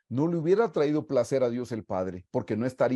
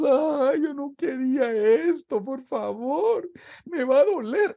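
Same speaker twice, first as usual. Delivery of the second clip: high, whimpering, pleading voice; mocking whiny Jesus; 13 semitones higher than usual. Preacher mocks the idea of Jesus complaining on the cross, high and whiny. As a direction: whine like someone begging to escape pain. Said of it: "perdone que se lo diga así".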